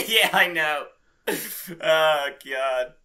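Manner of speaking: high and loud through laughter; agreeing while still cracking up; giggly agreement; laughing. Real, not acted. Laughing after joke.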